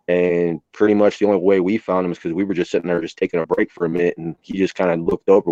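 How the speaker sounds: ordinary talk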